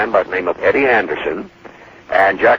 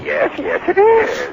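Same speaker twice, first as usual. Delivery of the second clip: high falsetto reply; slower than usual. Retold or imitated. Radio comic answering Elliott's song line in falsetto. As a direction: jump way up into a squeaky falsetto. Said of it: "we did every line of the song that way".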